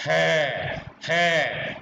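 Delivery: है drawn out slow and loud from the throat; throat sound demo. Shown as a demonstration. Teacher showing the voice coming from the throat. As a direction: breathe है out from the throat. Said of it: "फिर देखिए".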